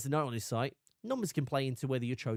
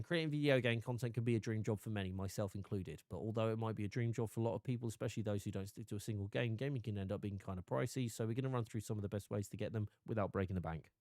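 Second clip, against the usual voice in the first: rushed read; 5 semitones lower, 7 dB quieter than usual. Shown as a demonstration. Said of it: "I could read that out going".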